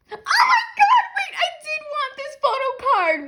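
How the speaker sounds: very high, loud squeal; overjoyed scream. Real, not acted. Fan getting a photo card they wanted, high overjoyed scream. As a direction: shriek it, overwhelmed with joy. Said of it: "I'm gonna cry".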